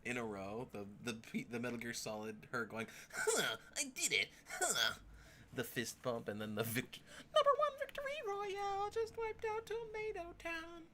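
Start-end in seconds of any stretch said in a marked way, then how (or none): 3.2-4.9 mock triumphant lady. Imitating the lady's victory line. 7.3-10.8 very high, fast squeaky victory boast; mock gamer brag. Streamer mimicking the lady's brag, squeaky high and fast.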